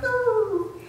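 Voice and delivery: put-on high playful ooh like a baby; playful pitch slide. Shown as a demonstration. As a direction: slide the ooh up like an infant playing. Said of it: "playing with pitch and moving it up and down".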